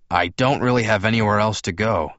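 ordinary delivery, nothing marked

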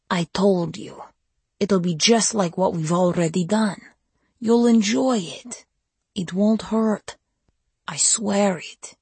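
slow, high soothing promise; slow coaxing. A narrator's reading. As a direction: lull her slowly into trust. Vampire coaxing her to turn.

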